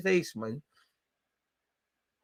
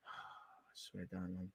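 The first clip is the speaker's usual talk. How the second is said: breathy, fed-up whisper under a sigh; exasperated whisper; 10 semitones lower, 26 dB quieter, much slower than usual. Real, not acted. Fan whispering in despair at his team's play. Sighing in frustration at the game.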